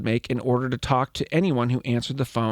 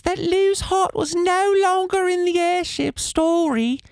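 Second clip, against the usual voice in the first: Dobby voice; 14 semitones higher than usual. Pretend. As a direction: read the quote as an eager little elf. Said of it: "That was a really good Dobby".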